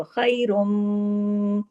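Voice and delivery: खैरुम with the meem hummed long through the nose; long ghunna. Shown as a demonstration. Teacher showing students the full nasal meem. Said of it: "गुन्ना हो रहा है उसी तरीके से".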